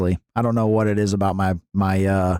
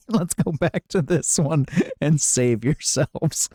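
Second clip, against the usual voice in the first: laughing; 5 semitones higher, slower than usual. Real, not acted. Laughing at gross food description.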